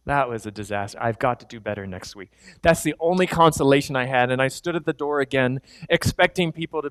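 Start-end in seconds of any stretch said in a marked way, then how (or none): 0.1-2.3 low, soft disappointment; dejected. Retold or imitated. Pastor recalling his dejection after the sermon. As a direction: sink into defeat.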